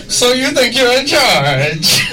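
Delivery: slow, lightly put-on Bane voice; bad Bane impression. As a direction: try a Bane line and miss. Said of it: "I'm horrible at impressions".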